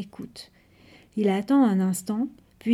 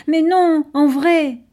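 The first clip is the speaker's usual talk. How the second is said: put-on higher, louder kid insisting; insistent protest; 7 semitones higher, 7 dB louder, slower than usual. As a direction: push back, eager to be believed.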